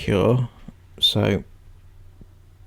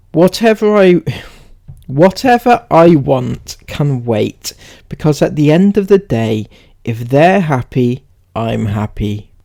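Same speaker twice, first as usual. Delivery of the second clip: louder, emphatic reading voice; read-aloud; 3 semitones higher, 7 dB louder than usual. Acted. Reading Sarah's post on her kids, emphatic read-aloud. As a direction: declare it line by line from the post.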